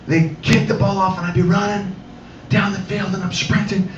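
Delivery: normal talking voice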